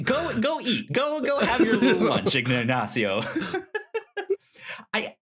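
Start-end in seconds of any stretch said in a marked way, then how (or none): 0.0-2.3 high laughing teasing shout; laughing tease. Real, not acted. Teasing cohost to eat lunch.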